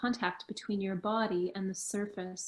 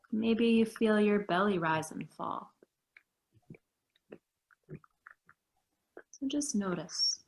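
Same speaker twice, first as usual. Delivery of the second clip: guided meditation. Acted. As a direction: guide them gently into stillness. Leads a meditation.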